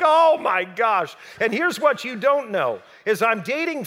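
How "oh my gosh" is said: dismayed. Retold or imitated. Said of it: "by now I'm freaking out".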